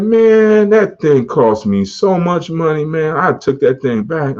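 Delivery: put-on high, slow New York accent. Old boss warning about car cost, slow New York accent. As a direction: drag it out like a New York guy who regrets it. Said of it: "He had this New York accent".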